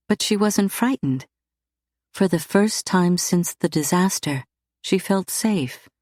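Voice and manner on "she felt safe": plain reading voice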